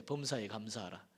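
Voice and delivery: "범사에 감사하라" said low and soft, a quiet prompting; hushed inner voice. Acted. God prompting the grumbling preacher on the bus, low and hushed. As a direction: murmur it low like God's nudge in the heart. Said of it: "하나님이 감동을 주신대".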